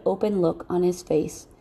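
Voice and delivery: plain reading voice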